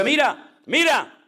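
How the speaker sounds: high, sharp call for attention. Acted. David showing Saul the cloth from afar, calling out. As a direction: call out to someone far off. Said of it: "le enseña el trozo de tela y le dice".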